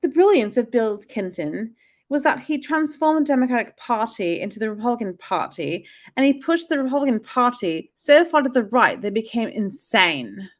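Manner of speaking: hybrid British accent, badly faked; attempted British accent. Shown as a demonstration. American reading a quote aloud for her friend, attempted British accent. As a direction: read it in an amateur mix of British accents. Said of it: "I'll read it in British accent".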